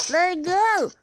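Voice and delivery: high, loud nasal cry; child in pain speaking through nose; through his nose. A narrator's reading. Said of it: "speaking through his nose".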